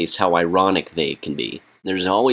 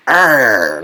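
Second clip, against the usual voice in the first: put-on loud kid's scary arr; mock monster growl; 5 semitones higher, 9 dB louder than usual. Vlogger imitating a kid scaring neighbors with a loud arr. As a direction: roar like a kid in a skeleton mask. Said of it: "runs around like".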